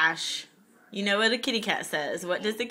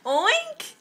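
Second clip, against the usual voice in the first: high pig oink; 12 semitones higher than usual. Retold or imitated. Parent echoes child's pig sound.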